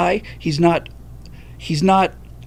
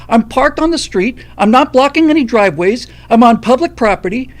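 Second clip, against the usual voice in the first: much higher, loud swearing rant; furious shouting; 5 semitones higher, 5 dB louder than usual. Retold or imitated. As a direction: yell it at the stranger, fed up. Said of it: "I dropped all the F-bombs because I was so fucking pissed off".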